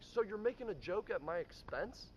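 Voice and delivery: high, indignant accusing question; offended boss. Retold or imitated. Boss accusing the valet of joking at his expense, high and indignant. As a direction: hurl it back, offended. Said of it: "And he's like".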